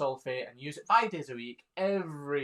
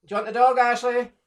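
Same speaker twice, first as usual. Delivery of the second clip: loud call, much higher; 10 semitones higher, 13 dB louder than usual. Real, not acted. Calling to Ashley somewhere else on the farm, loud call. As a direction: shout across the yard to Ashley.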